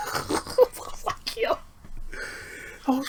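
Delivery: blurted through a real laugh; real laugh breaking into the words. Real, not acted. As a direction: crack up as you say it. Laughing at funny scene.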